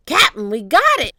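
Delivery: high, loud pirate cry; shout. Acted. Pinky shouts to the captain, loud. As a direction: call out in triumph. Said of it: "shouted Pinky".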